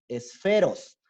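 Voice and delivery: "esferos" with a clear, audible S at the end; final S heard. Shown as a demonstration. Teacher models "esferos" for coastal speakers, S sounded. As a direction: finish the word, land the final S. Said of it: "que al final se escuche la S".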